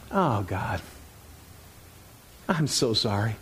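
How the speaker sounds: a quiet, sincere confession; humble prayer. Retold or imitated. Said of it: "like, going".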